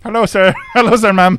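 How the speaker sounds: high, loud mimicked staff greeting; hammed-up greeter, pitched well up; imitated hotel staff. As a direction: imitate an over-eager hotel welcome. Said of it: "they would say".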